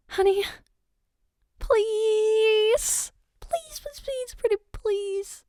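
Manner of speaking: high, whiny begging, climbing up; pleading. Acted. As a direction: beg like an eager child who can't wait. Begging partner to reveal look.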